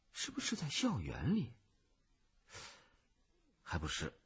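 softly thinking aloud, with long pauses; hushed, halting search of the memory; puzzled musing. Acted. Boy puzzling over a girl's photo, softly musing.

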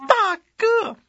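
very high, loud mock sobbing eunuch; hammed-up wailing. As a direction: wail it out like a fake-crying courtier, building to a shout. 皇后继续哭求. (夸张地假哭哀嚎)

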